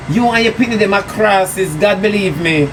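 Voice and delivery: usual speaking voice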